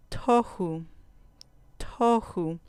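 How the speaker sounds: "tohu" with the first syllable stressed. Shown as a demonstration. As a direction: lean on the TO in "tohu", let hu fall. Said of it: "ahí va a caer el acento". ("tohu" con acento en la penúltima sílaba)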